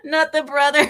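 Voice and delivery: loud, high, through laughter; laughing protest. Real, not acted. Host reacting to their own joke, laughing hard. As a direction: protest while cracking up.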